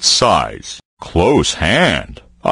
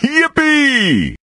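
high squealing yippee; excited cheer. Acted. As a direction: burst out with glee. Bear cheers.

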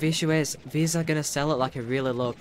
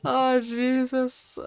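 real squeaky, very high-pitched laughing fit; crying with laughter at your own failure; helpless laughter; 3 dB louder, much slower than usual. Real, not acted. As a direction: wheeze the words through uncontrollable laughter. Cracks up at own failed voice.

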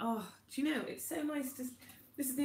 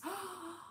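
sharp, soft intake of breath in delight; a quick wistful gasp; envious gasp; 8 semitones higher, 7 dB quieter than usual. Real, not acted. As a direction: gasp as if the snow were outside your window.

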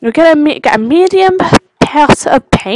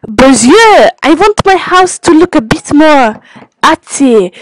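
hammed-up French accent, animated and pushed; 3 semitones higher than usual. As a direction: read it out like a theatrical French customer. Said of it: "My French impression".

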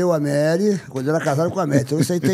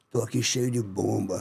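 droll joke; 6 semitones lower, 6 dB quieter, slower than usual. Retold or imitated. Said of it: "aquele jeito dele engraçado".

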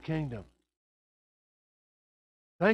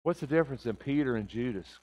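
normal talking voice

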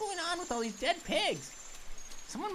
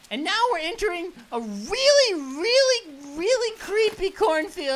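louder and higher on each really; building intensity; 5 semitones higher, 10 dB louder than usual. Real, not acted. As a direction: stretch the reallys, rising tension. Building fear description.

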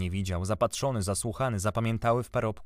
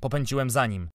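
ordinary delivery

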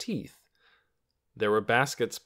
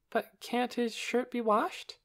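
high, slow, anxious question; puzzled plea; 13 semitones higher, slower than usual. A narrator's reading. Lena hopes the shirt can be saved, puzzled plea. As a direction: ask it slowly in a high voice.

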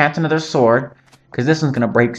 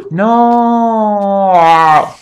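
dismayed cry; 6 semitones higher than usual. Real, not acted. Gasp then cry at game loss.

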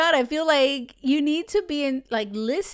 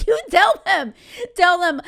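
laughing; 6 semitones higher, 8 dB louder than usual. Real, not acted. Laughing mid advice.

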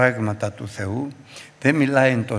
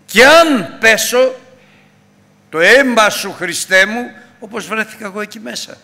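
impassioned preaching; 8 semitones higher, 6 dB louder than usual. Acted. Impassioned plea to Christ.

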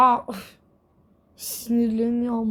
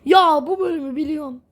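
excited recognition; 4 semitones higher, 6 dB louder than usual. Real, not acted.

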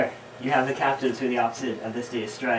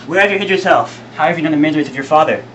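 loud, higher, quickening questions; urgent questioning; 4 semitones higher, 7 dB louder than usual. Acted. Lord asks the returned son about his father, urgent loud questioning. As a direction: press him urgently for answers. Eager questions to revealed Edgar.

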